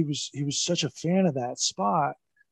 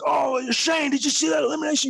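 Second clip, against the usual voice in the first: higher, bright eager question; gushing fan; 12 semitones higher than usual. Retold or imitated. Impersonating Pat Patterson stopping Shane to rave, excited. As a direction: imitate Pat raving to a passer-by. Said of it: "he was like".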